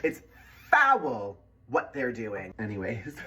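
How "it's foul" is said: mock disgust.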